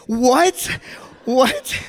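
high, rising double what; a friend who can't believe his ears; stunned disbelief. Retold or imitated. Friend shocked by the story.

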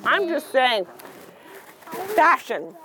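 ordinary delivery, nothing marked

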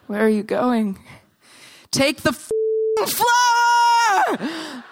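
building from high plea to a screamed last word; exasperated yell. Acted. Living statue to a passerby refusing the flower, exasperated yell. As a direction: lose all composure and scream it out.

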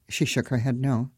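ordinary delivery, nothing marked

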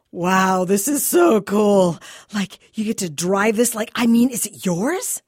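put-on a dazzled kid gaping at the car; awed teen gushing.

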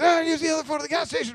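quick, high, put-on voice of a casual worker; fast-food guy. Carl's Junior guy brushing off the stranded kid, quick and high. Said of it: "And he goes".

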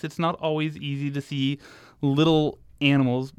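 normal talking voice

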